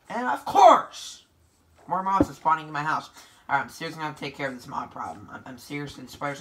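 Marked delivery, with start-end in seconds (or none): 0.1-1.2 high, loud, drawn-out complaint; slow rising wail of frustration; exasperated cry. Real, not acted. Player who just died, exasperated cry.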